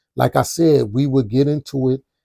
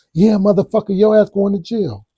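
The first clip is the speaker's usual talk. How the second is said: imitating her yelling, much higher and louder; yelling threat; 8 semitones higher, 4 dB louder than usual. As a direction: shout the jail threat like she did. Girlfriend yelling from the porch.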